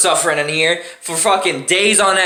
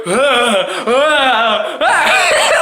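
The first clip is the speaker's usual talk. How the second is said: pained cries; 5 semitones higher, much slower than usual. Retold or imitated. Crying out while the nurse squirrels the swab. Swab pushed deep into nose.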